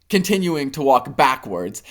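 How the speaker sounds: ordinary delivery, nothing marked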